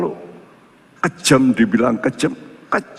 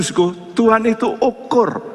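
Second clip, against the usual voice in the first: ordinary delivery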